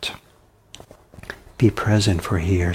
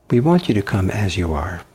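normal talking voice

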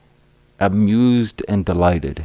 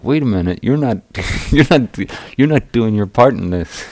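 laughing indignation; 5 semitones higher, 6 dB louder than usual. Retold or imitated.

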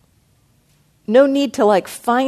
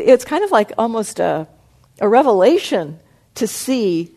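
normal talking voice

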